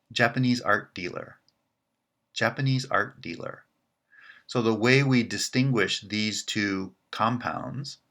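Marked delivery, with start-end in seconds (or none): 0.1-3.6 Japanese art dealer with the peak on Japanese; stress on Japanese. Shown as a demonstration. Teacher demonstrating a dealer in Japanese art. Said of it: "the stress pattern would be".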